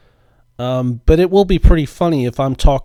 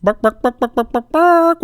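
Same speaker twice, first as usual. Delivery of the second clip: high yappy barks climbing to a squeal; silly rising dog barks; barking like a dog; 11 semitones higher than usual. Pretend. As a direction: bark like a hypnotized little dog. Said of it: "This is probably the dumbest thing I've ever done".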